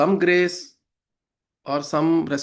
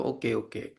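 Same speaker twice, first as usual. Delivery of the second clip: low, soft, unbothered okay; detached hush; 6 semitones lower, 9 dB quieter than usual. Retold or imitated. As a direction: calmly brush it aside, softer. God's detached response to crying.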